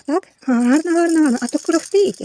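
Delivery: high, loud admiring coo; sing-song motherly enthusiasm; playful baby talk. Pretend. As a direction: marvel for a baby's benefit. Cooing over rattle to baby.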